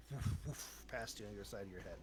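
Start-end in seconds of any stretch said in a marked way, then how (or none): 0.1-0.8 two puffed, voiceless whooshes; whoosh of air. Shown as a demonstration. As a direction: imitate air rushing past the head. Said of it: "feel air kinda go".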